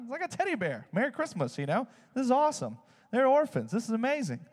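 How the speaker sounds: high, gushing delight at a teddy bear; excited. Retold or imitated. The children receiving teddy bears, high excited gush. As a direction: squeal it like a thrilled orphan child.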